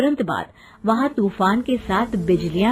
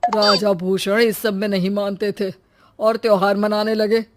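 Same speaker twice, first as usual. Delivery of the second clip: villager telling legend; 7 dB louder than usual. Acted.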